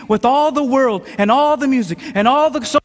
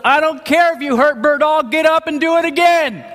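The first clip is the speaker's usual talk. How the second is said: yelling coach; 6 semitones higher than usual. Retold or imitated. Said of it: "And the coach goes".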